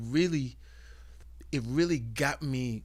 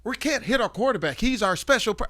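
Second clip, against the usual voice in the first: mock surprise; 9 semitones higher, 6 dB louder than usual. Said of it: "I was like".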